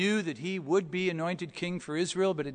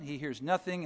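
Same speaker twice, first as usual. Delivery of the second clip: normal talking voice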